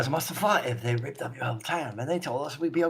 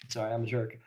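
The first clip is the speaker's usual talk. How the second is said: ordinary talk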